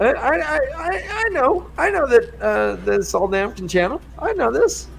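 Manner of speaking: hammed-up flustered insistence; mock stammering character. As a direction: squeak it out like a nervous know-it-all. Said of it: "they're like".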